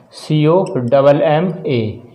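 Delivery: letters spelled out slowly; slow spelling. Shown as a demonstration. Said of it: "teacher spelling the word out". (धीरे-धीरे अक्षर बोलना)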